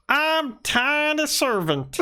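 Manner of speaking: high, slow, proud little robot; tiny servant voice. Pretend. Tiny servant introducing itself, high and slow. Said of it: "And then he says".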